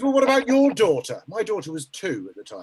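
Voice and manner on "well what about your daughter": higher, louder pointed question; friend's loud challenge. Retold or imitated. Friend on the phone fires back, pointed and loud. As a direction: throw the question back hard. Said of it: "He goes".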